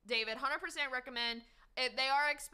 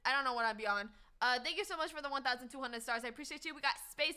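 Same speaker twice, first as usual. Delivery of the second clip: normal talking voice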